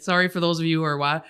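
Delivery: ordinary talk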